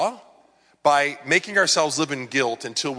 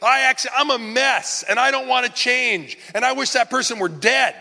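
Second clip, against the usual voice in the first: higher and louder, pleading outburst; raw desperate confession; 10 semitones higher, 4 dB louder than usual. Pretend. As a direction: pour it all out to God, high and raw. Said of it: "or whatever this thing is".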